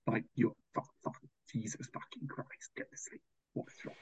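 breathless, voiceless whisper of frustration; whispered curse. Real, not acted. Lying awake in the tent, cursing the campers in a whisper. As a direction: hiss it under your breath so the tent next door can't hear.

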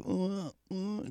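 high broken grunts of a man missing half his head; garbled groan. Retold or imitated. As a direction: grunt it high, unable to form words. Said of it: "can't really talk 'cause half your head is gone".

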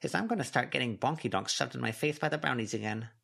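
high voice, grumbled under the breath; grumbling Snowdrop. A narrator's reading. Snowdrop complaining to Avery, muttered. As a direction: grouse like the little Snowdrop. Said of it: "Snowdrop muttered".

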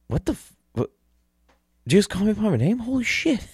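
high-pitched, acted-out astonishment; imitating his own amazed reaction; starstruck disbelief. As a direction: act stunned, rising into a squeal. Retelling his astonishment at being recognized.

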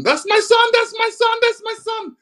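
high, excited call of a mother at the airport; mom shouting. Retold or imitated. Mother greeting her son off the plane, high excited shout. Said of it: "I could hear my mom shouting".

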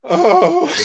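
a high, teary oh; moved to tears; tearful high exclamation; crying oh. Real, not acted. Touched listener crying out.

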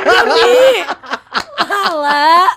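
loud, high laughing words. Real, not acted. Co-host laughs at prank.

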